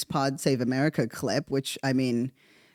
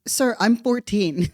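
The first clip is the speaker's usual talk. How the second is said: higher, louder and slow, in disbelief; stunned retort; 7 semitones higher, 4 dB louder, slower than usual. Retold or imitated. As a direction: spell out the obvious to the teacher, flabbergasted. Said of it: "I was just like".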